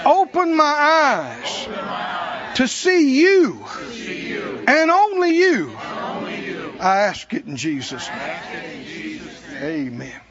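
fervent prayer, slowing and swelling. Acted. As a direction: pray it aloud, building, then hush for the amen. Closing a prayer aloud.

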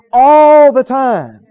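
ALL held long and loud; slow, ringing stress on "all"; drawn-out emphasis. Real, not acted. Preacher drives home his point.

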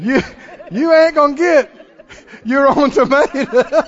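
high, loud, laughing through the punchline; gleeful laughing punchline to the congregation; laughing shout. Real, not acted. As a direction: crow it out while laughing.

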